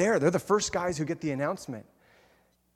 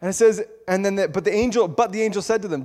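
normal talking voice

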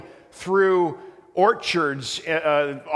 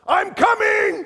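very high, loud eager shout; distant holler; 7 dB louder, slower than usual. Retold or imitated. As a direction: yell back from far down the path. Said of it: "we just hear from the distance".

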